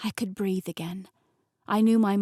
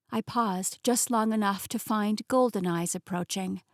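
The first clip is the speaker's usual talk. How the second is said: usual speaking voice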